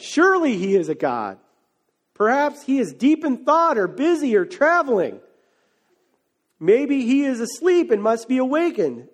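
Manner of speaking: theatrical mockery of a silent god; taunting. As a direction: taunt them with swooping pitch. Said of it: "began to taunt them".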